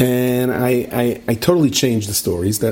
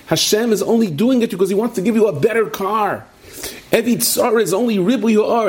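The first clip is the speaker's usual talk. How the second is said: higher, sing-song preachy comfort; mock pious platitude; 10 semitones higher than usual. To a friend stranded in snow, a mock pious platitude. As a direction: hand out empty holy reassurance, much higher.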